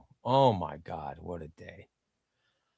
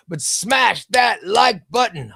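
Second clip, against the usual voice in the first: slow, louder hype shout; 5 semitones higher, 5 dB louder, slower than usual. Acted. Streamer reading a chatter's message, slow and shouted like a hype man.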